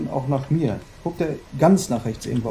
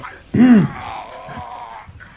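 loud strained yell; frustrated yell; 8 semitones higher, 6 dB louder than usual. Real, not acted. Gamer failing the escape, loud frustrated yell. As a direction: let out the frustration.

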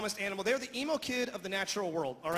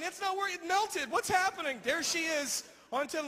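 usual speaking voice

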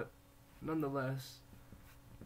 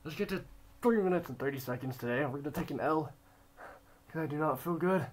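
louder, straining through pain; biting down on pain; 2 semitones higher, 5 dB louder than usual. Real, not acted. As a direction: force the words out while hurting. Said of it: "I'm in a lot of pain".

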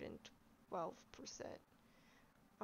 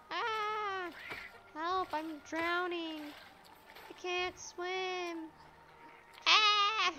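high, slow mock cries for help; hammed-up panicked drowning wails; 8 semitones higher, 5 dB louder, much slower than usual. Pretend drowning in the game.

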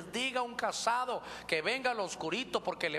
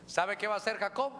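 ordinary delivery

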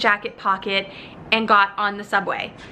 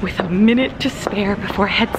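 whispered into the phone at the airport; hushed, breathy-free whisper. Real, not acted. Flight attendant whispering her vlog update at the gate.

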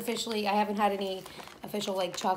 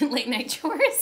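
slow, laughing drawn-out repeat; 3 semitones higher, 4 dB louder, slower than usual. Shown as a demonstration. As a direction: laugh through the words. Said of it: "repeats the phrase with a laugh".

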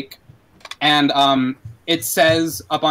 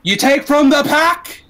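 higher, louder and slow; gunman's threat; 10 semitones higher, 5 dB louder, slower than usual. Acted. Axel warning the street, slow and loud. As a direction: lay down the law of the gang. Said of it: "and he says".

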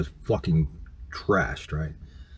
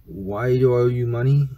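slow, higher-pitched disbelieving question; incredulous; 5 semitones higher, 3 dB louder than usual. Retold or imitated. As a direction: draw it out, baffled by the demand. Retold argument over money demand.

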